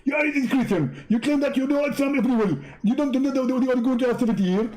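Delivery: high, brushed-off mock voice; mocking Zakir Naik voice. Preacher to visitor at the door, mock high voice. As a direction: play a busy preacher waving him away.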